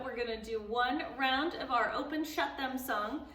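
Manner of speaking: ordinary delivery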